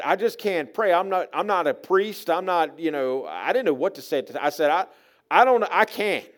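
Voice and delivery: loud, high, halting refusal; rattled, stumbling excuse; flustered protest. Acted. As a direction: plead that you are unable. Flustered refusal to the sick man.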